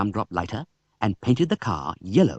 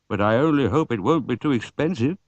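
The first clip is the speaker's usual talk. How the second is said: a hint of a worried old man; old man fretting over the price; lightly voiced old man; 3 semitones higher than usual. Mr Oldcastle voiced.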